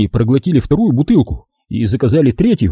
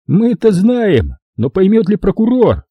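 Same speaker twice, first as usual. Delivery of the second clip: slow-starting, higher-pitched quip; wisecracking; 7 semitones higher than usual. A narrator's reading. Long guy taunts Dinka about the prosecutor, wisecracking. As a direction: draw out the opening, then needle her. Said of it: "сострил Длинный".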